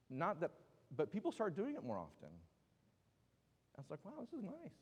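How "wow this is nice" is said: high, soft acted wonder; pleased wonder. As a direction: lightly marvel at a nice surprise. Acts own pleased reaction to change.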